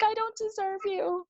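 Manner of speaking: high, squeaky mock-humble line; bashful squeak. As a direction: play coy and flattered. Mock-humble reply to boyfriend.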